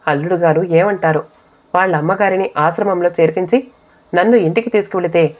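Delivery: ordinary delivery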